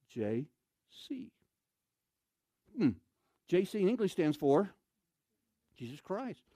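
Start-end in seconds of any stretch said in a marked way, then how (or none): none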